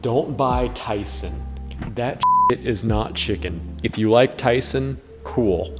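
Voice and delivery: ordinary talk, nothing marked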